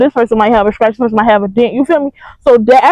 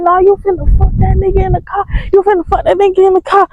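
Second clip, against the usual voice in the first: higher, insistent retold accusation; mock jealous accusation; 7 semitones higher than usual. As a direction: imitate an accusing ex, pitched high. Ex's jealous accusation, acted.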